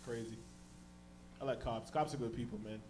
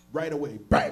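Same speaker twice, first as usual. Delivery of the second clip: sudden loud bang; 10 dB louder than usual. Retold or imitated. Sudden hit of the smoke.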